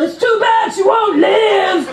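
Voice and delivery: high, drawn-out acted shout; slow, high call across a rooftop; movie yell. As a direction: yell it dramatically like a film line. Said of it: "the guy yells at Harrison Ford".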